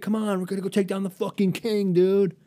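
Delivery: rile your friend up for battle; amped, high-pitched bro urging; hyped-up buddy. Acted. Acted friend hyping up a fight.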